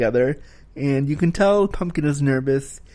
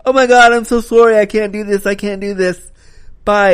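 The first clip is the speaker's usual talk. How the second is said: high, loud frightened babble; flustered girl fleeing in a panic; mock panic, backing away in fear; panicked girl; 7 semitones higher, 7 dB louder than usual. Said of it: "runs off screaming and is like".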